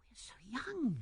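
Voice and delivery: high, soft, whispery protest; timid and hushed; woman's hushed voice. A narrator's reading. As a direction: voice her doubt in a small airy voice. Hesitant woman answers proposal.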